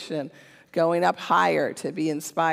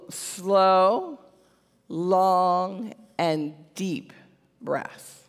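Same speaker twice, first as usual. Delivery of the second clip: slow and drawn out; much slower than usual. Real, not acted. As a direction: stretch each word, pausing between. Drawing out words to match meaning.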